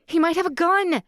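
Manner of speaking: high, loud panicked scream. Acted. Woman warning her boyfriend about the gun, terrified scream. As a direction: shriek the warning in terror. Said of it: "Blakely screamed".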